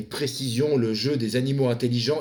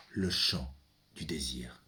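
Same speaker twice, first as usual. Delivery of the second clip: slow breathy whisper, almost no voice; 9 semitones lower, 9 dB quieter than usual. Acted. Son whispering to his father, slow hushed whisper. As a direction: hush the words like a secret prayer. Whispered line in a recited poem.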